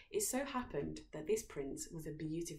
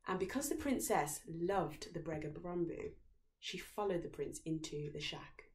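ordinary delivery, nothing marked

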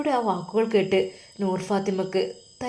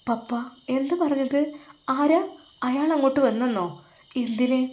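startled questions; 6 semitones higher than usual. Acted. Daughter shocked, questions papa.

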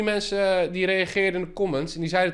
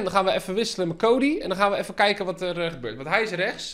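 ordinary talk, nothing marked

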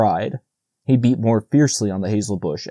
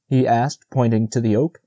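plain reading voice

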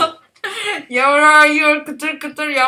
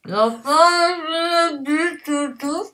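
cheeks stuffed full; 4 semitones higher, slower than usual. Real, not acted. Mouth crammed, laughing between tries.

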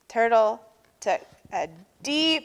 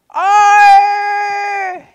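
high, very loud, long screech; drawn-out tantrum shriek; 11 semitones higher, 13 dB louder than usual. A narrator's reading. Everyone keeps up the fake tantrum, screeching. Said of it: "screeched everyone".

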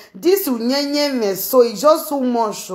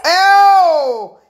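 laughing cry; 6 semitones higher, 6 dB louder than usual. Real, not acted.

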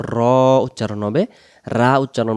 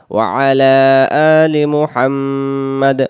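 drawn-out, louder recitation; slow recitation; 5 dB louder, slower than usual. Shown as a demonstration. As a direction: recite slowly with long vowels. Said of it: "দম ছেড়ে দিলে". (ধীর তিলাওয়াত)

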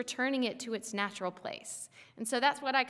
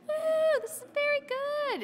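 much higher, bright exuberant whoop; jazz hands excitement; 4 dB louder than usual. Shown as a demonstration. Preacher showing God's delight in creation, high excited whoop. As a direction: throw your hands up with delight. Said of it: "it's more like jazz hands".

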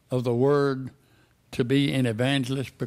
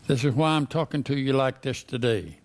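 usual speaking voice

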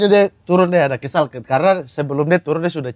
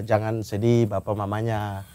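softer and slower, soothing the parents; gentle reassurance; 7 semitones lower, 8 dB quieter, slower than usual. Retold or imitated. Groom comforting the bride's parents at the engagement, softly. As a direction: ease them like a kind son-in-law. Quoting his speech to parents.

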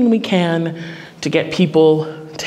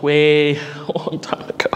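laughing aside; 3 semitones lower, 4 dB quieter than usual. Real, not acted. Joking about own college days.

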